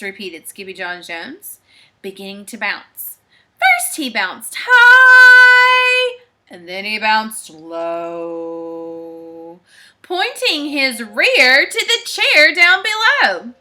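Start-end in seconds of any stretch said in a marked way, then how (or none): 3.6-6.2 high, loud, long-held 'high'; bouncing high. A narrator's reading. 6.5-9.6 low, drawn-out 'low'; slow sinking voice, long low note; bouncing low. A narrator's reading.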